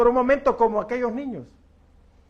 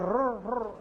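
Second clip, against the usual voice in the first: soft rolled "rrr" of a hungry belly; growling stomach imitation; 3 semitones higher, 5 dB quieter than usual. Recalling childhood hunger to the church, soft belly growl.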